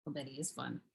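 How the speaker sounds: very soft, low mutter; quiet aside. Pretend. Turning from the little guy to oneself, quiet aside. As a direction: confide it to yourself, dropped right down. Same words as private aside.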